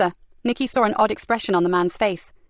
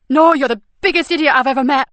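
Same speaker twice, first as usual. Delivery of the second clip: tearful outburst; 5 semitones higher, 7 dB louder than usual. Acted.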